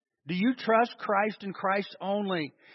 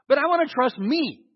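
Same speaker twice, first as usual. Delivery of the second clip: a whiny self-reliant objector, higher and louder; mock objector; 7 semitones higher, 6 dB louder than usual. Preacher voicing a congregant who trusts himself, mock protest. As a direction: play the stubborn believer who wants to trust himself.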